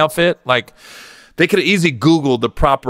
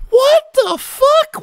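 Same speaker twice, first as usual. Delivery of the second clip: incredulous; 3 dB louder, much slower than usual. Real, not acted. Incredulous reaction to the scene.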